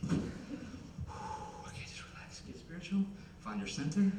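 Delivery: soft, near-whispered start, staying quiet; calm yourself down under your breath; hushed self-soothing. Acted. Nervous moviegoer talking himself down, hushed and soothing. Calming himself in theater seat.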